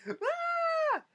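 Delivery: very high shout of terror; terrified scream. Retold or imitated. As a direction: scream while fleeing a killer clown. Grandson runs for his life.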